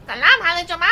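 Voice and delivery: high, loud, nasal Persian dub; nasal dubbed voice. Retold or imitated. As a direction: squeeze it through the nose. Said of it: "high-pitched through their nose".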